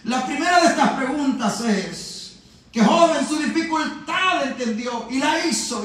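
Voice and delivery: normal talking voice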